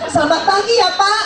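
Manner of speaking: high, bubbly good morning; overeager greeting. Retold or imitated. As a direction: chirp it out far too cheerfully. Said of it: "Saya sampai".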